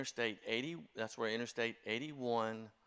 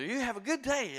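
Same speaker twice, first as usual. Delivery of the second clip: high, bright-faced farewell; beaming clerk; 13 semitones higher, 4 dB louder than usual. Retold or imitated. Clerk saying goodbye to the church staffer, beaming and high. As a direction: send him off like a grinning shopkeeper. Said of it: "suddenly he was so happy".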